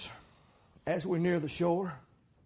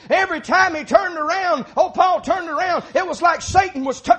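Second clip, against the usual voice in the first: high-pitched, raised pulpit voice; fiery preaching; 12 semitones higher, 8 dB louder than usual. Acted. Preacher describing Paul being buffeted by Satan, high fiery preaching. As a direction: preach the blows with rising force.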